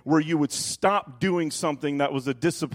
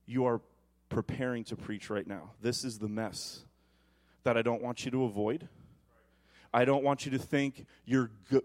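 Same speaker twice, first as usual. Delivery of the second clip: lower, much softer gentle voice; voice of the Holy Spirit; 4 semitones lower, 7 dB quieter than usual. Retold or imitated. The Holy Spirit to the pastor, soft and low. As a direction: speak softly as God's calm voice. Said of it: "the Holy Spirit stops me".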